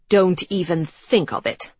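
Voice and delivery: put-on stern older woman, loud; stern command. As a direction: shut it down firmly. Mother forbids cigarette.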